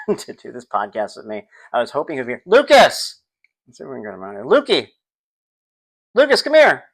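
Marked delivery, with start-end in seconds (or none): 2.5-3.2 loud call across the house. Real, not acted. Calling son into room. 4.4-6.8 high loud call to another room. Real, not acted. Calling son into room.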